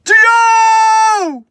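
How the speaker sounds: shouted name, very high and loud; distant scream. Retold or imitated. Buddy answering from the woods, calling out loud. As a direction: yell back a name from far off. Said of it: "I hear my buddy scream from a distance".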